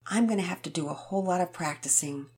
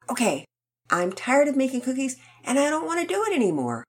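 put-on higher, faster kid complaint; whiny declaration; 8 semitones higher, faster than usual. Rocket announces he is done making cookies, whiny. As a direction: whine it like a bored boy. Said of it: "Rocket declared".

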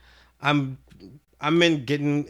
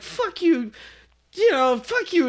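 high-pitched imitation of a partner; mocking woman's voice; 10 semitones higher, slower than usual. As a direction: put on a high voice for the retort. Acts out the woman in corner.

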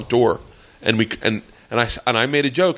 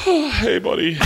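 trembling voice; 8 semitones higher than usual. Retold or imitated. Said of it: "it is fucking trembling".

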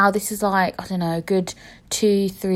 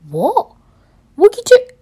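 disbelief; 13 semitones higher, 8 dB louder than usual. Real, not acted. Outraged question at the memory.

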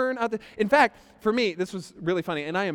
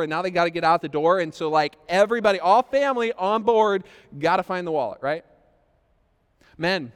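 ordinary talk, nothing marked

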